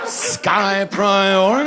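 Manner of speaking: Sky priority, drawn out with pride; Sky priority, each syllable savored grandly; savoring. Retold or imitated. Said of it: "certain ring to it".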